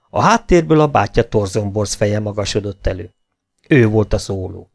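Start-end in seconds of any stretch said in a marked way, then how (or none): none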